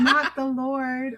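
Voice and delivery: drawn-out laughing protest; laughing slowly. Real, not acted. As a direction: laugh through the words, slow. Echoing with laughter.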